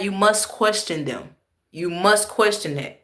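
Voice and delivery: ordinary talk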